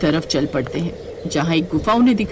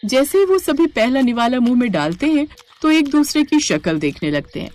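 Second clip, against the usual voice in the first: normal talking voice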